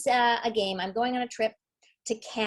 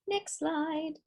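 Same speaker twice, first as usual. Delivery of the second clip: sing-song call; 8 semitones higher, 4 dB quieter than usual. Real, not acted. Calling for the next slide.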